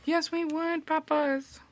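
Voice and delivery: high silly voice for the dogs; puppy voice. Pretend. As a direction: speak as the pet, squeaky. Answers for the dogs.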